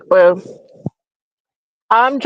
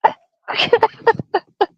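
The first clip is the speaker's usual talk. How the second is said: breathless, squeaky laughing words; gasping for air between laughs; out of breath from laughing; 13 semitones higher than usual. Real, not acted. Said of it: "Oh, let me catch my breath".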